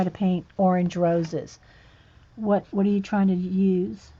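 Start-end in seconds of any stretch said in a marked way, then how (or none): none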